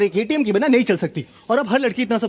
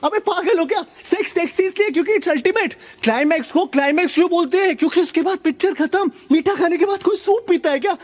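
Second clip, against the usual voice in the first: high-pitched incredulous rant; exasperated disbelief; 6 semitones higher than usual. Acted. Man ranting at women who want talk afterwards, exasperated. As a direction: argue it in exasperation, voice pushed up high.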